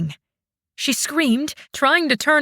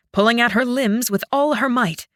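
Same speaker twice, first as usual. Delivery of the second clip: ordinary delivery, nothing marked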